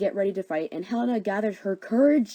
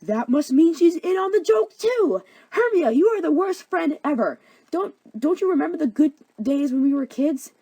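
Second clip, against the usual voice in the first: high, loud, hammed-up indignation; outraged accusation; 7 semitones higher, 7 dB louder than usual. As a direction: cry betrayal at a best friend. Helena accuses friend of betrayal.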